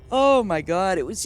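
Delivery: ordinary talk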